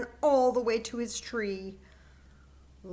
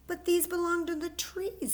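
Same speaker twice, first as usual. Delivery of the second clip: upset little bear; 10 semitones higher than usual. Acted. Fletcher protests to squirrel.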